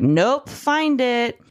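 high, drawn-out dismissive demand; mock curt refusal. Narrator imitating the girlfriend ordering him to search, dismissive.

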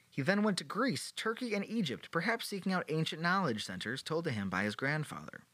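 ordinary talk, nothing marked

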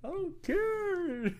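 high and very slow whine; mock whiny reply. Playing Andre, dragged-out reply.

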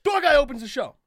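high, loud impatient insistence; insistent boss. Retold or imitated. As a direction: overrule him like the boss.

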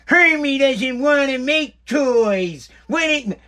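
lightly voiced bossy head elf, slow and drawn out; a hint of the nagging head elf barging in; mock head elf scolding Hermie, slow. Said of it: "she comes bombing in".

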